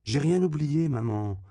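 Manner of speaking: high, loud retort; shouting back. A narrator's reading. Daughter calls back to her mother from another spot, shouting. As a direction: call back to mother, pitched right up. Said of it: "lui cria sa fille en retour".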